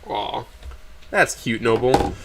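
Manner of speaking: quiet, touched aside; soft aside. Real, not acted. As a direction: murmur it off-script.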